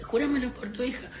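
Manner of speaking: high-pitched pressing demand; mock insistence. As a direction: act out pushing him to swear. Acting man demanding an oath.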